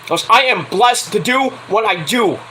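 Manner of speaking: ordinary talk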